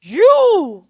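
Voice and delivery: you shouted high and loud as a surprise reveal; dramatic reveal. Shown as a demonstration. Teacher reveals the shocking pronunciation to the class, loud. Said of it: "surprisingly pronounced as".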